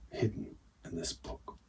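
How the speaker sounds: plain reading voice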